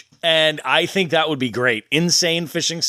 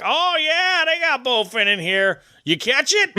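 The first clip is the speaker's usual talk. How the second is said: high-pitched imitation of a local angler; put-on higher voice, eager; drunk fishing regular; 11 semitones higher than usual. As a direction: mimic an excitable pond regular. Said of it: "And he's like".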